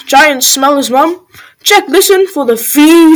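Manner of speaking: plain reading voice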